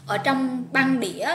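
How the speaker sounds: ordinary delivery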